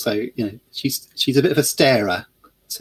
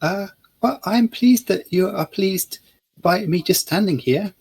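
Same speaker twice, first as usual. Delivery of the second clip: put-on polite young woman; 6 semitones higher, slower than usual. Lily answers old man.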